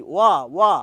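ওয়া stressed, said twice and loud; isolated wa sound. Shown as a demonstration. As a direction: isolate the ওয়া glide, punch it. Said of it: "ওয়া ধ্বনিটা কোথা থেকে আসছে".

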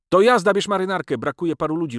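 loud start, excited high pitch; brisk urging. Acted. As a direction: hurry a friend along. Friend hurries him out to work.

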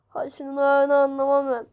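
normal talking voice

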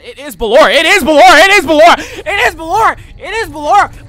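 repeated chant rising to a loud cry; building panic. Real, not acted. Realises Ballora is attacking, builds up.

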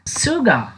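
sugar said "shooga", no final R; teacher modelling sugar without R; silent R. Shown as a demonstration. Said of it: "that R is silent".

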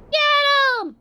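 very high, loud cheer; piercing battle cry; excited shout. Real, not acted. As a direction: yell it high like egging on an ally. Cheering on another ship in game.

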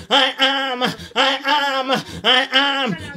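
ordinary talk

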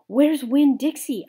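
high, loud panicked shout. A narrator's reading. The narrator shouts for her lost dog, loud. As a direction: cry out in alarm. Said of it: "I shouted".

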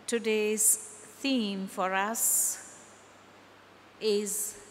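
ordinary talk, nothing marked

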